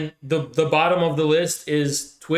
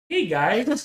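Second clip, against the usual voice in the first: mock Peter Griffin voice, higher; lampooning her as Peter Griffin; 7 semitones higher than usual. Teasing the co-host with a Peter Griffin voice. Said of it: "You talk like Peter Griffin".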